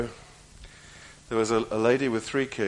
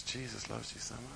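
tender; 15 dB quieter than usual. Retold or imitated. Kind man's tender answer.

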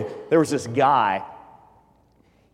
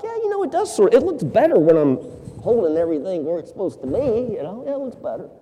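much higher, fast, wavering agreement; half-convinced customer; 7 semitones higher, 4 dB louder, faster than usual. Acted. Customer hesitantly agrees with the tailor, high and wavering. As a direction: talk yourself into liking it.

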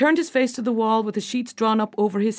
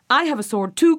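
defiant, louder and higher retort; crying out; 5 semitones higher, 5 dB louder than usual. A narrator's reading. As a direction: answer the threat with a raised voice. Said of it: "cried the boy".

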